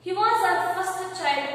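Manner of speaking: loud, high emotional reading. Shown as a demonstration. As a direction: read it as a mother pleading. Reading Kunti's emotional line.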